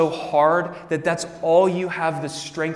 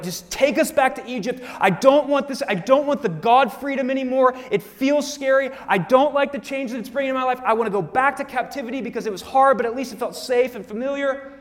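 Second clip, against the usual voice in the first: higher, panicky whining Israelites; freaking out; 7 semitones higher than usual. Retold or imitated. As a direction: plead to go back, scared of freedom. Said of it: "they're freaking out".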